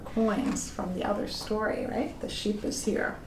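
hushed, pointing out the sheep; whispered aside. Real, not acted. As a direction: whisper it like a little secret. Aside to child, picture.

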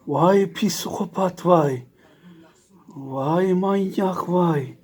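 slow, drawn-out mock scolding; slow teasing drawl. Real, not acted. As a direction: stretch every vay out teasingly.

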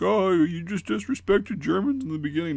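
high-pitched whining mock complaint; mock whiny objectors. Mimicking everybody who'll object, mock high-pitched whine. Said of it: "everybody's gonna be like".

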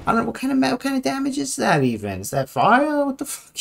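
high incredulous voice; baffled squeak. Real, not acted. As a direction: squeak it out in disbelief.

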